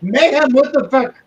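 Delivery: outraged shout. Real, not acted. Player yelling at Mayhem's line in disbelief, loud.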